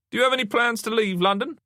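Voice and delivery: high-pitched detective interrogating; questioning detective. A narrator's reading. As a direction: press the witness in a high voice.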